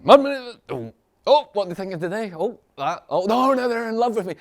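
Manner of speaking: very high, loud gushing; hammed-up giddy rush of new love; mock giddy excitement. Teacher imitating an emotional person swept up, hammed-up giddy.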